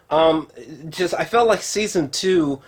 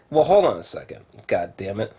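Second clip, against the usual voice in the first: usual speaking voice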